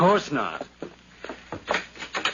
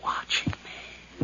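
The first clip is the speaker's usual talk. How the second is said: slow, hushed, fearful whisper; paranoid whisper; 7 dB quieter, much slower than usual. Acted. Nephew whispering his fear of the inspectors. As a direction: let it slip out under the breath. Killer paranoid under questioning.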